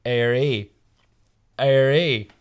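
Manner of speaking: "Airy" as a lightly voiced Belfast "all right"; a hint of Belfast menace behind a balaclava; Northern Irish accent. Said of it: "in a Northern Irish accent".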